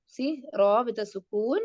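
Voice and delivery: ordinary talk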